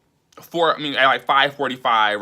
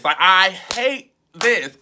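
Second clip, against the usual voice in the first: higher, slow, choked-up repeat; 5 semitones higher, slower than usual. Retold or imitated. Reliving the breakdown at work, slow, higher and near tears. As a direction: voice rising as it breaks. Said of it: "so overwhelmed and just, like, flustered".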